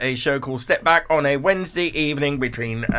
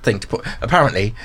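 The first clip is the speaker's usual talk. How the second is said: ordinary talk, nothing marked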